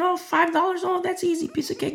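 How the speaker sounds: breezy, high-pitched shrug of a voice; lightly mocking how easy it sounds; mock carefree. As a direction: toss it off like it costs nothing. Voicing a carefree attitude.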